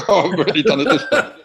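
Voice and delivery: high, loud laughter through the words; laughing. Retold or imitated. Comedian recalling the disaster, laughing loudly. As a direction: laugh helplessly at the mess.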